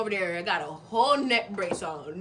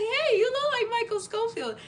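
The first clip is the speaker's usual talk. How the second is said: high, far-off blurt of a dazed patient; mock-dreamy blurt at the paramedic; dazed starstruck blurt; 14 semitones higher, 4 dB louder than usual. Dazed crash victim blurts to the paramedic, high and dreamy. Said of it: "I was just like".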